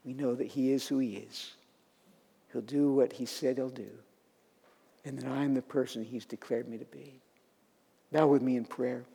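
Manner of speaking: low, soft, settled delivery; quiet assurance. Real, not acted. Preacher leading the congregation into prayer, quiet and low. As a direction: bring the voice down gently and unhurriedly.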